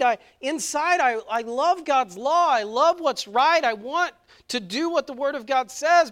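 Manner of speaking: full-force preaching. Acted. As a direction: drive the point home from the pulpit.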